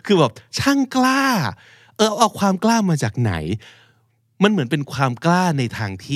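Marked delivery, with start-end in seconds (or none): 0.6-3.3 high-pitched acted incredulity; mock amazement at someone's nerve; indignant disbelief. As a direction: play affronted, voice pitched well up. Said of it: "อาจจะในใจหรือพูดออกมาเลยว่า".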